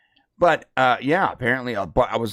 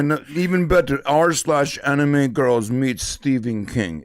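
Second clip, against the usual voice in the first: put-on Russian accent; 3 semitones higher than usual. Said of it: "speak with a Russian accent".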